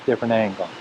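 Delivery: usual speaking voice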